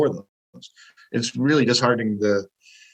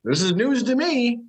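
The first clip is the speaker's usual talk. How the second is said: high, drawn-out astonishment; real surprise; 12 semitones higher, slower than usual. Real, not acted. Podcaster hearing of unexpected listeners, surprised. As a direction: say it slowly, pitched high in disbelief.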